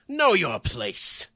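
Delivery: loud, high, drawn-out command; hammed-up villain's order. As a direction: put them down with a slow shout. Villain's threat before an attack.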